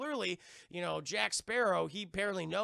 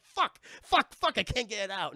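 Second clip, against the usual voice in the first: high, loud frantic swearing; panicked cursing; 4 semitones higher, 7 dB louder than usual. Retold or imitated. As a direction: yank at a stuck sword and curse. Stuck sword, cursing in panic.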